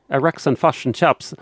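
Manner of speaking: clipped New Zealand vowels; broad kiwi accent. Shown as a demonstration. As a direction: Fish and Chips said the kiwi way. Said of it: "they talk, they clip their eyes".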